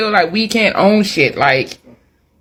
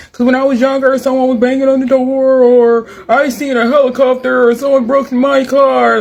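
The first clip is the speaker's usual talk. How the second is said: higher, mocking voice for her excuses; mock whiny excuse; 5 semitones higher than usual. Mocking the woman's trauma excuse, higher whiny mimicry. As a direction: parody her sob story in a whiny tone.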